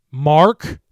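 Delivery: sudden loud cry of alarm; shout. A narrator's reading. Jen warns Mark of the creature, sharp shout. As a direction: yell a warning. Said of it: "heard her shout".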